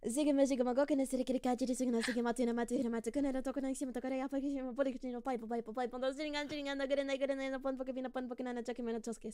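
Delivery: fast memorized tongue-twister name; rapid-fire name recital. Shown as a demonstration. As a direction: rattle off the long name fast. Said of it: "I take any opportunity to do that bit".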